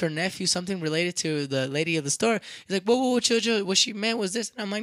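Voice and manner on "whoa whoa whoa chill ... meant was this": higher, louder whoa-whoa interruption; retold intervening. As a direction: jump in to smooth things over. Relative steps in to calm argument.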